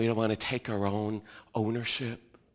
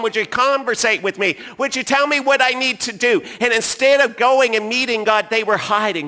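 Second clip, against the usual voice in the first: loud, high preaching voice; full-force preaching; 10 semitones higher, 14 dB louder than usual. Acted. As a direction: preach it out to the whole room. Preaching about hiding from God.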